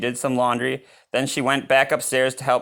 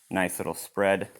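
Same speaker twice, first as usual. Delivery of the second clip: ordinary delivery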